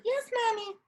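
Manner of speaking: put-on very high, soft little-kid reply; imitating children in a high-pitched voice; kids' voice. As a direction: answer as an obedient small child, high and squeaky. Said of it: "And they're like".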